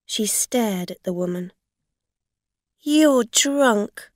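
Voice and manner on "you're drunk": loud, raised indignant charge; angry accusation. A narrator's reading. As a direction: accuse them outright.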